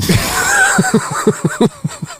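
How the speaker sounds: big high-pitched laugh; real helpless burst of laughter. Real, not acted. Laughing at the statue joke punchline, high helpless laughter.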